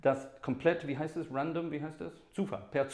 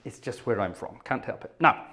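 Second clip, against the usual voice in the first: normal talking voice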